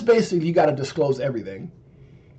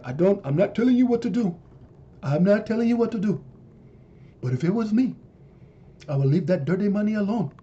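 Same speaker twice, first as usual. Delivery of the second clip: higher, jacked-up African president impression; lightly done Coming to America African leader voice; 4 semitones higher than usual. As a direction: play an African president slyly advising you to drop the dollar. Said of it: "I heard that in Coming to America, that voice".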